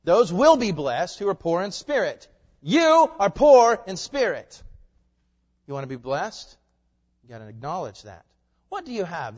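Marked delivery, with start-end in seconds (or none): 0.0-4.6 loud, high stress on "will" and "You"; "will" hit hard, then "You" drawn out high and loud; emphatic rereading. Shown as a demonstration. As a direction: punch "will" in "will be blessed" and "You" in "You are poor". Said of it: "you need to read that this way".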